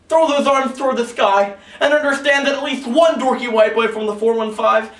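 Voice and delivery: loud, high, shouted anthem finale; slam climax. Acted. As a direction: whip up the crowd to a big finish.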